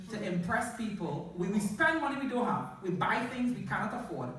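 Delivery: ordinary talk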